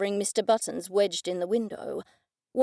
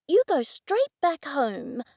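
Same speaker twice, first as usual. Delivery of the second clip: exclaiming; 6 semitones higher, slower than usual. Acted. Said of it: "I exclaimed".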